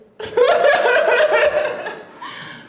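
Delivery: loud high burst of laughter. Real, not acted. Telling the audience about Mad Men, bursting into loud laughter. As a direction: laugh out loud at your own story.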